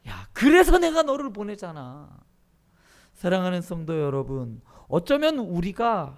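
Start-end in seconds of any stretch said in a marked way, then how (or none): 0.1-2.1 loud, insistent comeback; God's retort. Acted. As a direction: drive the answer home at full volume. Voicing God's exasperated answer. (큰 소리로 힘주어 되받아치기)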